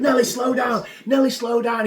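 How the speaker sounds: high, loud yell at the driver; panicked shout. Retold or imitated. Yelling at reckless driver.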